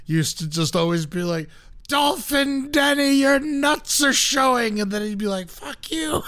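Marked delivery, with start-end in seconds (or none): 1.9-4.8 high, slow, drawn-out heckler's call; heckling. Retold or imitated. Son and dad at the mascot on the field, heckling. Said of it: "like, heckling the guy". 5.5-6.2 high, softer mock yell; mock angry retort. Mascot back at the heckling fans, mock angry retort.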